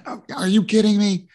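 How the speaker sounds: high, loud alarmed outburst; mock-panicked dad, pitched high and loud; freaking out. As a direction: panic right along with him. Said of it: "I freaked out with him".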